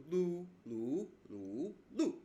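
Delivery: Lu flat, rising, dipping, then falling sharply; four tones of Lu. Shown as a demonstration. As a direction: run Lu through all four tones. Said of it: "you have these four".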